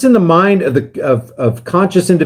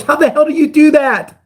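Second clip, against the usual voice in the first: pitched way up in disbelief; outraged rhetorical question; exasperated; 9 semitones higher than usual. Real, not acted. Ranting host fumes about lost trillions, exasperated and high.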